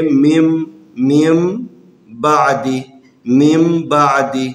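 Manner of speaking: مین بعدی read as میم بعدی, with m before b; n turned to m. Shown as a demonstration. Teacher shows students how مین بعدی becomes میم بعدی. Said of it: "نون کی آواز کو میم کی آواز میں تبدیل".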